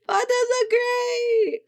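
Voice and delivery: high, loud gushing cry; delighted squeal. Real, not acted. Streamer gushing to chat over her character's intro. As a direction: coo over him in delight.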